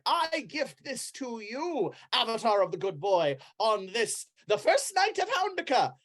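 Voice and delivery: high, grand proclamation; ceremonial Brother Flufferkin. Acted. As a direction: bestow it like a holy gift. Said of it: "He's like".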